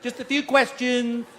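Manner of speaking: lightly voiced chirpy counter girl; mock sweet desk girl. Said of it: "a little girl behind the counter goes".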